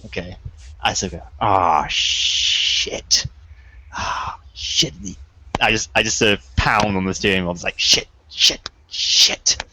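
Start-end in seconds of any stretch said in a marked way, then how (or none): 1.4-4.9 long voiceless sighed curse; breathy dismay. Acted. Player acts dismay in stalled car. 7.8-9.6 voiceless, forceful repeated curse; hissed frustration. Acted. Pounding on the steering wheel.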